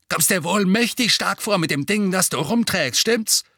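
sneering challenge; taunting. A narrator's reading. Taunting cousin in narrated quarrel.

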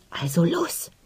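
a sharp, voiceless whisper; hissed whisper. A narrator's reading. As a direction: hiss the order under your breath. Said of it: "zischte der Anführer".

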